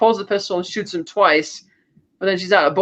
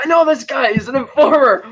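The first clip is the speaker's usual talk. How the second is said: raised, pitched-up mock accusation; urgent accusation; 7 semitones higher, 3 dB louder than usual. Henchman exposing an informer to his boss, urgent accusation. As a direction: act out denouncing an informer. Retold henchman denounces the hero.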